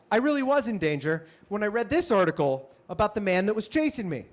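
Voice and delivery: ordinary talk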